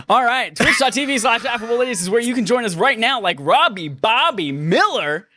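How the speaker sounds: high, slightly loud hyped announcer read. Acted. Host plugging the Twitch stream in a lightly hyped announcer voice. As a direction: pump up the stream plug.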